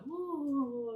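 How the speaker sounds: high drawn-out "ooh"; high, wondering creature sound; impressed creature ooh. Retold or imitated. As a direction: coo like an intrigued little creature. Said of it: "some of the other ones like".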